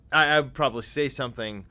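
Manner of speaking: ordinary delivery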